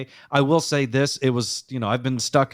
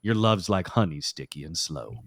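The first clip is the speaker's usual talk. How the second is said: lower, softer sign-off on the lyrics; NPR host deadpan; 5 semitones lower, 5 dB quieter than usual. Acted. Host finishing the filthy R and B for co-host, hushed NPR deadpan. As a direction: let the sober radio reading sink quietly to a close. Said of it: "Caught on to my delivery, did you?".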